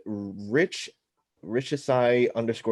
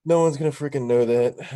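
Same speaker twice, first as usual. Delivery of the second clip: louder, higher, exasperated; 5 semitones higher, 5 dB louder than usual. Real, not acted. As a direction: throw your hands up at it. Exasperated repeat of the remark.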